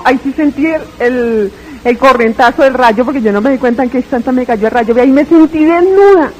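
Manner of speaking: ordinary talk, nothing marked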